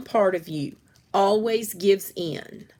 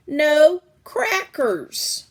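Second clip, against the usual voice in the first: No crackers, slow and louder; slow firm no; 8 semitones higher, 7 dB louder, much slower than usual. A narrator's reading. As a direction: lay down the law, word by word. Clementine scolding.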